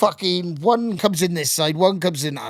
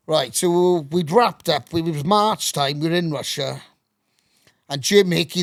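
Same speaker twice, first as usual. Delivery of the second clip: ordinary talk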